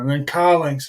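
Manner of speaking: normal talking voice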